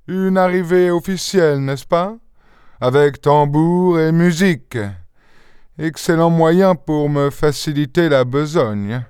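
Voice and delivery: high-pitched sarcastic grumble; put-on character voice, sourly unengaging; grumbling. A narrator's reading. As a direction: grumble with off-putting irony. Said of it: "bougonna l'Anglais".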